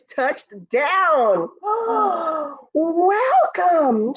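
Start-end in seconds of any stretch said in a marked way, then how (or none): 1.5-2.7 high, excited gasp of joy; delighted gasp. Acted. Moon gasps at landing. 2.7-4.1 high, thrilled cry of welcome; joyful welcome. Acted. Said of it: "she greeted the men".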